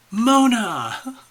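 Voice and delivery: loud, high delighted call of a name; light up and call out to a friend; excited greeting. Real, not acted. Host spotting a latecomer in chat, calling out with high, loud delight. Mona just showed up at the last moment.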